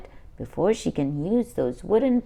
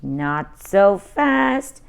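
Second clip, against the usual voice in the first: slow, deliberate not so fast; firm stop; 4 dB louder, much slower than usual. Acted. Ruby blocking brothers from her fort, slow firm. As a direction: drag it out to stop them.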